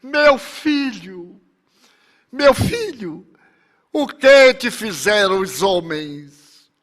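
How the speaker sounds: high, drawn-out anguished cry; mother's cry. A narrator's reading. Mother crying to her son on the cross, anguished high cry. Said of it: "olhou para ele e gritou".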